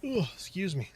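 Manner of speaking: talking through a yawn. Real, not acted. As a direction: yawn mid word. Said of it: "Yawning my butt off tonight".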